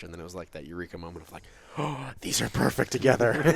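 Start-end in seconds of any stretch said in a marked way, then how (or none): 1.8-3.5 excited. Retold or imitated. Eureka moment, delighted quote.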